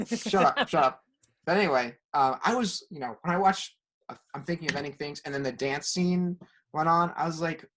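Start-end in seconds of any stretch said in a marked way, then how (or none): none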